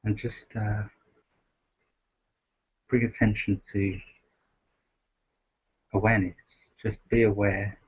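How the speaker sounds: soft, unhurried meditation voice with long pauses; guided meditation. Acted. Host guiding the group to rest in awareness, softly meditative. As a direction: gently settle listeners into stillness, leaving space between phrases.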